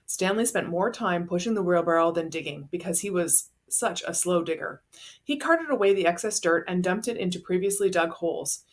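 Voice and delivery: usual speaking voice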